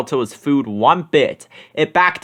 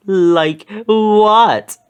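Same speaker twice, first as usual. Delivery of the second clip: higher, louder jittery question; nervous Shaggy; 6 semitones higher, 5 dB louder than usual. A narrator's reading. Shaggy asking Fred about the noise, higher and nervous. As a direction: ask as if dreading the answer. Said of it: "Shaggy asked nervously".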